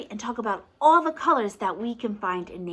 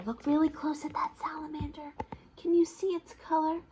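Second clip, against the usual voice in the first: fast whispered coaxing; hushed whisper; 6 semitones higher, 6 dB quieter, faster than usual. Acted. Reader whispers to the child to look closely. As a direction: draw the child in with a secret whisper.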